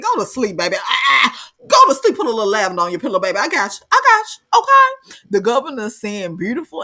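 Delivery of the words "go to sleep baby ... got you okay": soothing mama voice building to high and loud; mama lulling him. Retold or imitated. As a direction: start as a lullaby, then push up higher and louder. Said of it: "Mama said".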